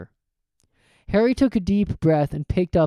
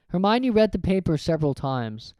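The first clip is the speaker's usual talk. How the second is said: usual speaking voice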